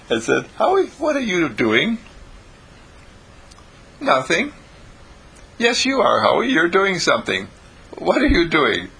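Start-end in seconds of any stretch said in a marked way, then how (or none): 0.6-2.0 put-on high-pitched girl's question, rising; high child voice, prying; questioning sister. Marie questions her brother. 4.0-4.5 put-on high-pitched child's denial; high, evasive boy; innocent little brother. Howie denies it to sister. 5.6-8.9 put-on high, insistent child voice; high-pitched sibling demanding answers; insistent sister. Marie presses her brother.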